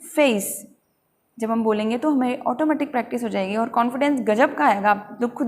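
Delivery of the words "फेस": फेस as feys with a glide; diphthong. Shown as a demonstration. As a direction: let e slide to ee in फेस. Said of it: "कैसे पढ़ना है".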